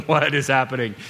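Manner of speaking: low, hushed mock bewilderment; stunned onlookers muttering in disbelief; startled disbelief. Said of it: "The startled looks of the disciples".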